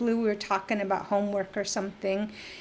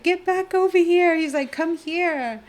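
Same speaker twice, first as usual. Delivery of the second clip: high, loud call-back; calling out after someone; 9 semitones higher, 8 dB louder than usual. Retold or imitated. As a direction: shout after someone running off. Calling after runaway girl.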